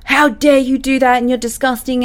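the harsh way she chose not to say it; mock outraged telling-off. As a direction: pretend to snap at the teens in disgust. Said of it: "I wasn't like".

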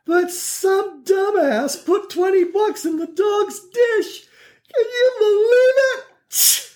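put-on very high, slow outraged old-lady shriek; indignant grandma. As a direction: squeal it like a scandalised old grandma. Grandma voiced in a story.

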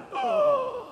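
a sharp, high, frightened gasp; startled gasp. Retold or imitated. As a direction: gasp like a kid caught out. Kid startled by dad's call.

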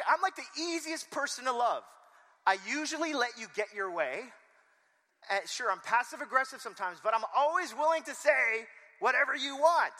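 very high, loud mock outrage; hammed-up defensive shouting; indignant protest. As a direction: yell it like a wounded, baffled husband. Acts Bill's indignant protest.

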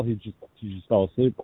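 normal talking voice

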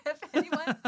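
high, loud words through real laughter; trying to talk while cracking up; laughing. Real, not acted. Breaks up laughing mid-sentence.

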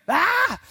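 loud sudden scream. Shown as a demonstration. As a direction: let out a raw yell. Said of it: "your prayer is just screaming".

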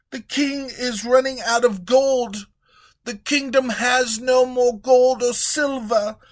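high treasurer reporting empty coffers; worried treasurer. Acted. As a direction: deliver bad news carefully.

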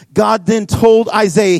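usual speaking voice

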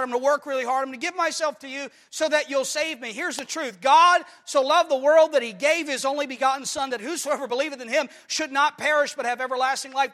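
loud, ringing pulpit preaching; full-force preaching. Acted. Preacher urging the church, loud and fervent. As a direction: preach it out with fire to the congregation.

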